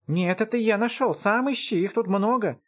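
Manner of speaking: put-on high-pitched child guarding his find; squabbling kid. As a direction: argue like a little brother. Младший брат спорит за находку. (спорящий детский голос, высоко)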